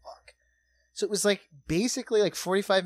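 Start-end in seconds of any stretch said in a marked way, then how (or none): 0.0-0.3 breathless whispered curse; whispering. Retold or imitated.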